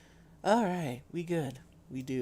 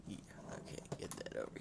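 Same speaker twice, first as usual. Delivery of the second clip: whispering; 13 dB quieter, faster than usual. Real, not acted. Muttering aside.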